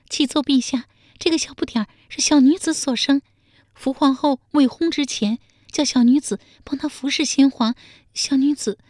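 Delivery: high-pitched, anxious maid's confession; frightened palace maid. A narrator's reading. Maid confesses to the emperor the baby is hers, frightened. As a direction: explain yourself in a high servant girl's voice.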